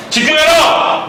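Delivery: sudden loud, high shout at the class; real shout. Real, not acted. Teacher to inattentive students, a loud high shout. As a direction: bellow the command to snap them awake.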